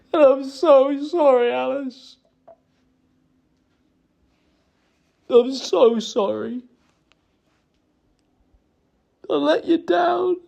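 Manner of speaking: high, choked-up pleading with long pauses; really breaking down as you apologise; halting, high-pitched remorse; tearful apology. Real, not acted. Breaking down while apologising to Alice.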